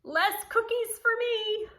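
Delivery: high, mock-dismayed kids' voice; kids' worry. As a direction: play the disappointed children. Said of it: "They're like".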